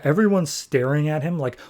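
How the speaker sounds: normal talking voice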